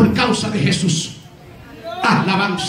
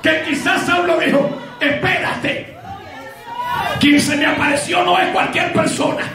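fiery preaching; 5 semitones higher than usual. Acted. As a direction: preach it out at full force to the congregation. Preacher at full force. (predicación a todo pulmón)

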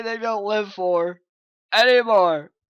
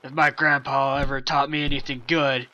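usual speaking voice

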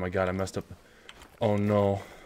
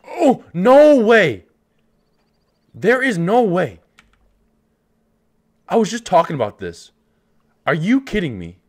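high, loud and stretched, can't believe it; thrilled disbelief; 9 semitones higher, 10 dB louder than usual. Real, not acted. Gamer spotting a great one, shouting in excited disbelief. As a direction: burst out in shocked delight. Spots rare animal in game.

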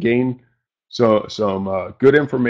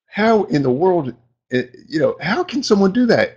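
retold amazement; 8 semitones higher than usual. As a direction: replay your own baffled reaction, pitched up. Retelling own amazed reaction.

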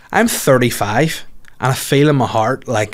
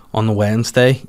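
usual speaking voice

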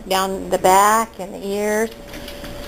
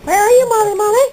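higher, louder call for the puppy; 11 semitones higher, 5 dB louder, faster than usual. Real, not acted. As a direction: call her name across the room. Calling the puppy.

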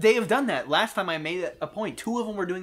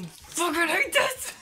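high, strained half-voiced outburst; slow, squeezed, despairing rage; strangled frustration; 11 semitones higher, slower than usual. Real, not acted. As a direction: choke out your hatred of the game. Failing at the game.

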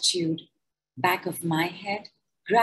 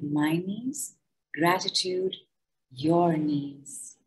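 guided gratitude meditation. Acted. Leading listeners through a body gratitude decree, calm meditation voice. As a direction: guide them gently through each body part.

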